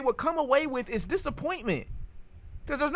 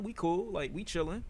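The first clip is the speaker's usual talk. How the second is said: usual speaking voice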